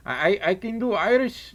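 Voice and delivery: Irish accent slipping into Scottish; attempted Irish accent. Shown as a demonstration. Claiming Irish blood to chat, shaky Irish accent. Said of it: "that sounded more Scottish than Irish".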